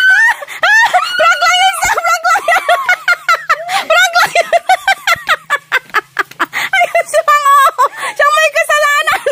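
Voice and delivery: high, loud laughing yell; laughing shout. Real, not acted. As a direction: yell it while cracking up. Prank revealed, laughing. (sumisigaw habang tumatawa)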